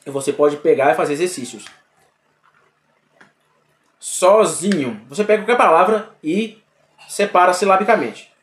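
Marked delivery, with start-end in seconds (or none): 4.0-5.0 "Sozinho" said slowly, syllable by syllable; slow teaching demo of "Sozinho". Shown as a demonstration. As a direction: draw out "Sozinho" to show its syllables. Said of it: "Isso você pode fazer com outras palavras".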